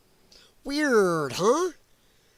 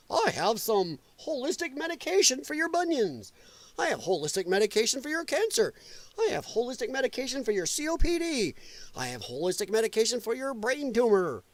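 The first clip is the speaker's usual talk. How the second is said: higher-pitched, sing-song mock sales pitch; mock holistic seller; 5 semitones higher, 3 dB quieter than usual. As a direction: reel off remedies like a mocked health-store hawker. Voices holistic store sellers mockingly.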